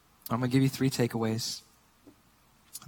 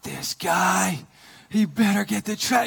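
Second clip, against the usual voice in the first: higher-pitched mock grumble; fuming, retold; 5 semitones higher, slower than usual. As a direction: grumble in comic indignation at the driver. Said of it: "like, fuming".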